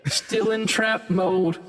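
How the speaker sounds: high, long-drawn robotic sung greeting; autotuned trap-star drawl; trap mode. Pretend. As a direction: sing it out like an autotuned rapper. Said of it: "I should probably switch it back".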